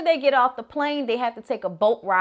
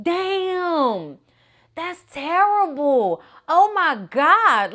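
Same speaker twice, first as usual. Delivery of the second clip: slow, high, aghast; horrified reaction; 5 semitones higher, 4 dB louder, much slower than usual. Retold or imitated. Reacting to the movie murder, slow horrified disbelief. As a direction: drag out the shock, getting louder. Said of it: "I was like".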